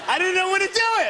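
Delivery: laughing. Real, not acted. Botched the boomerang, laughing.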